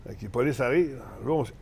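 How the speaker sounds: ordinary delivery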